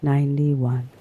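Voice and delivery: plain reading voice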